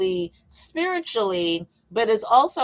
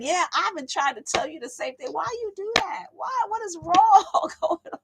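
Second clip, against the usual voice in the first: high-pitched mock exasperation; acted high-voiced disbelief; exasperated God; 9 semitones higher than usual. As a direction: plead in a high, comic voice. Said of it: "it's God is probably up in heaven".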